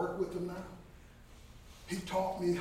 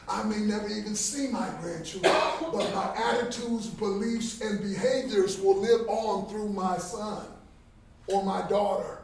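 rising, louder preaching build; 4 semitones higher, 9 dB louder than usual. Acted. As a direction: preach it out to the crowd, slow at the end.

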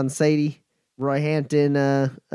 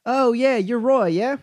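high, raised call across a garage; a friendly higher-pitched shout from another room; calling out from afar; 10 semitones higher than usual. Acted. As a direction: call out to someone you can't see yet. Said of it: "a voice calls out from the garage".

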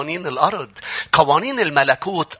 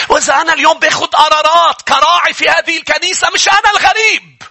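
rising, higher and louder preaching that ends in shouts; preaching at full force; 12 semitones higher, 5 dB louder than usual. Acted. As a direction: drive the sermon up to a fervent peak. Preacher peaks again. (وعظ بحماس متصاعد)

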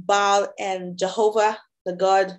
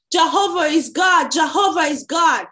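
raised, higher, louder proclamation; crowd shouting; 8 semitones higher, 6 dB louder than usual. A narrator's reading. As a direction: cry it out like an awestruck crowd.

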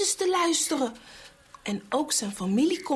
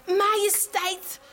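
higher, slow begging; pleading servant; 7 semitones higher, slower than usual. Acted. The servant begs the king, a high slow plea. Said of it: "De bediende smeekt".

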